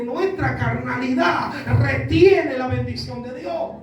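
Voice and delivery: forceful sermon delivery, building to a shout; preaching. Acted. Preacher warns congregation about the voice of man, forceful preaching. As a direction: preach it out, stretching the key phrases.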